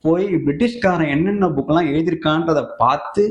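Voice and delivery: normal talking voice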